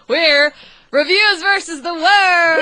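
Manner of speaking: drawn-out shouted show title; loud. Pretend. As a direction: belt the show name slowly.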